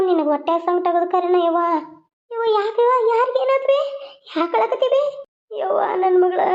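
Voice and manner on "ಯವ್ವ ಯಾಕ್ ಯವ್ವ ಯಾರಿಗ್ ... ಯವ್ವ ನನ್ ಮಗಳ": high, worried calling out; a mother pleading to know what happened, voice up high; anxious mother. Acted. Mother asks her crying daughter what happened, high and anxious.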